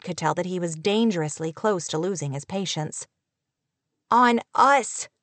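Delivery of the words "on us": mild wail, both words punched; a touch of exasperated wailing. A narrator's reading. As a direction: wail it as if it's obvious. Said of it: "she wailed".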